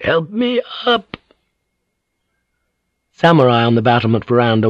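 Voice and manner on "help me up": put-on high, slow, frail old lady; old woman. A narrator's reading. Old woman asks her maid for help, slow frail plea. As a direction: ask slowly and weakly, high-voiced. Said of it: "her voice hoarse and frail".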